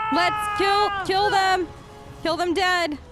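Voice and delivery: normal talking voice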